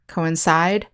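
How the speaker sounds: "coincide" stressed on CIDE, tune rising; co-in-CIDE, rising as in a list; last-syllable stress. Shown as a demonstration. Teacher recapping the word forms, stressing CIDE in "coincide". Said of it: "the stress is on the last syllable".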